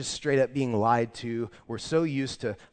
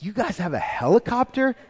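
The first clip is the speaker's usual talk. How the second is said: high, loud, wide-eyed amazement; amazed question; 10 semitones higher, 6 dB louder than usual. Retold or imitated. To the store owner, thrilled at the helicopter news: amazed and loud. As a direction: gasp it out like you can't believe your luck.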